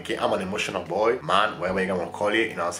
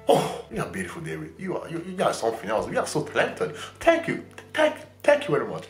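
mock self-praise; 6 semitones higher than usual. Complimenting himself as David.

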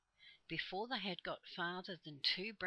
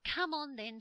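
high, loud rallying call; eager urging; 8 semitones higher, 7 dB louder than usual. A narrator's reading. Bessie rallies the others to the tree, high and eager. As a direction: urge them on eagerly.

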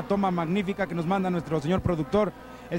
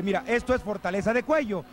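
usual speaking voice

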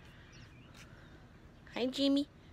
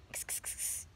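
cat calling hiss; 14 dB quieter than usual. Real, not acted.

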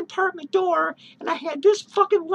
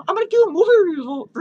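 excited announcing; 2 semitones higher, 7 dB louder, faster than usual. Real, not acted. Fast loud announcement.

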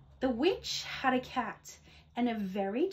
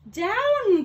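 a high, loud cry of Down; cried out; 11 semitones higher, 11 dB louder than usual. Acted. Witch shouting to her cat to land. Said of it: "cried the witch".

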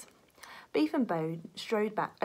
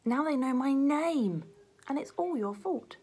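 upset blame; 7 semitones higher than usual. Acted. Beef blames partner, upset.

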